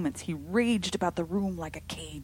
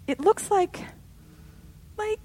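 higher and louder, stumbling on the words; horrified stammer; 7 dB louder than usual. A narrator's reading. Missus Brigham staring at the shadow, stammering in horror. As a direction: choke on it in horror. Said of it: "stammered Missus Brigham in a tone of intense horror".

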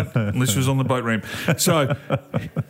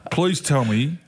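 normal talking voice

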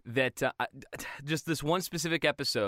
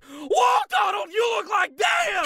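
screaming cartoon holler, very high; huge high-pitched goofy shriek; 7 dB louder, slower than usual. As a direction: yell it at the top of your lungs. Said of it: "Goofy is just screaming".

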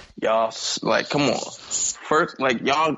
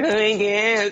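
mock dismissive; 7 semitones higher, slower than usual. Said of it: "you had a mad soliloquy to be like".

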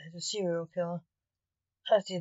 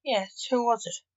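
a touch of frustration, pitched higher; frustrated; 4 semitones higher than usual. A narrator's reading. Simon pressing Lance to name the killer, mildly frustrated. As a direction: press for the answer, mildly exasperated.

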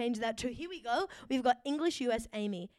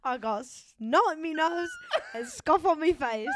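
hammed-up chimney-sweep urchin, higher pitched; mock British orphan; 5 semitones higher, 4 dB louder than usual. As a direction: play a scruffy British orphan, half laughing. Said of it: "like a little orphan".

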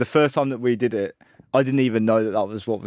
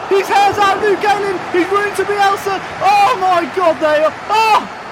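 screaming commentator, much higher, louder; goal scream; 10 dB louder than usual. Pretend. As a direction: lose it over the goal.

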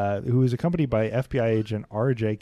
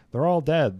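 higher, louder gunman's call; raised voice of a fleeing gunman; narrated shout; 6 semitones higher, 6 dB louder than usual. A narrator's reading. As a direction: call it out, short of a full shout. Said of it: "was heard to shout".

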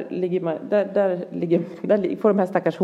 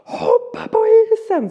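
high, loud, drawn-out yell; acted desperate shout, pitched way up; retold scream; 5 dB louder, slower than usual. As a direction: scream the order slowly down the phone. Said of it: "skriker jag bara så här".

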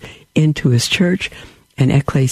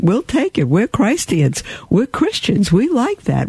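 proud believers; 8 semitones higher than usual. Retold or imitated. Believers embracing the name.

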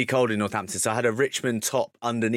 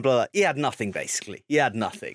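ordinary delivery

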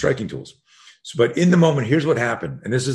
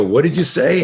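louder, higher bully's challenge; confrontational; 7 semitones higher, 5 dB louder than usual. Retold or imitated. Bully stepping up to the boy who swore, confrontational. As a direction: imitate a bully squaring up.

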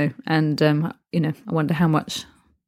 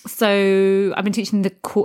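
ordinary talk, nothing marked

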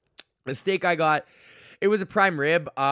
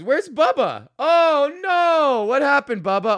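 alarmed surprise; 12 semitones higher, 8 dB louder, slower than usual. Real, not acted. Alarm at patron quitting.

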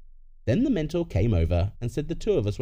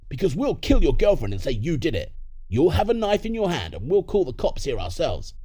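lightly voiced villain, somewhat raised; threatening parent; 5 semitones higher, 3 dB louder than usual. Criminal parent threatening to frame the son, mild menace. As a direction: spell out the threat with a touch of menace.

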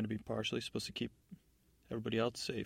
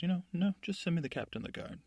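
higher, dismissive godmother order; lightly voiced godmother; 7 semitones higher, 4 dB louder than usual. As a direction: wave him off in a higher voice. Godmother orders the jailer around.

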